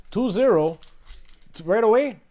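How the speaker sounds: excited shouting at a goal; loud then suddenly hushed. Real, not acted. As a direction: yell it out, celebrating. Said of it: "Sorry, that was my inside voice".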